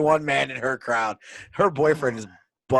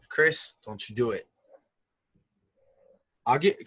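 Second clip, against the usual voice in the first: normal talking voice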